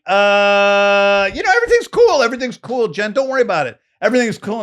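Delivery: high, strained fake reassurance; fake calm. To Jen hiding the proposal, strained high fake calm. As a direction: cover the panic with a squeaky everything's cool. Faking calm to his girlfriend.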